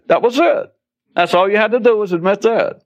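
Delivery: high-pitched voice of a mock church spokesman. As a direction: put on a high, pleased preacher's voice. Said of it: "you have churches go".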